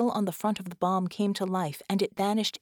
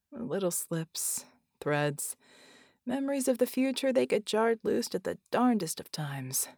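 barely able to speak; weak whispery man. Acted. Red's weak voice.

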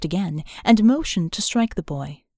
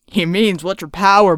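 laughing; 8 dB louder than usual. Acted. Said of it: "the Reb leader laughed".

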